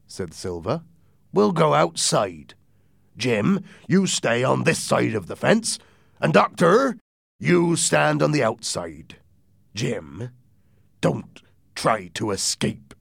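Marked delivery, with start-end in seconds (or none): 1.3-12.9 drawn-out pirate orders; raised, deliberate commands, easing into a slow warning; commanding pirate captain. Acted. Silver ordering Jim and doctor, deliberate.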